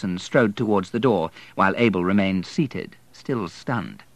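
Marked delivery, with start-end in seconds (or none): none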